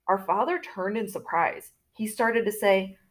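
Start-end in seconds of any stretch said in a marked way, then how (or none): none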